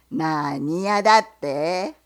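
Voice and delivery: low, loud, slow gruff question; bear. Acted. Bear asks the fox what he sells, low and loud.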